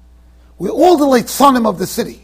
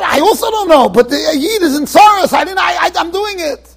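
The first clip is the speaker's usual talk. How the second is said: insistent defense; 7 semitones higher, 4 dB louder than usual. Retold or imitated. Rav Pesach defends himself.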